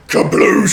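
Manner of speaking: loud kabloosh effect; splash sound. Acted. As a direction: shout out the splash.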